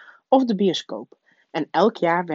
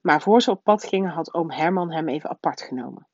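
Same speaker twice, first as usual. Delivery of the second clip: ordinary delivery, nothing marked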